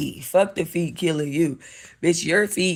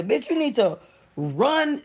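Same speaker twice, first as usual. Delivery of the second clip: normal talking voice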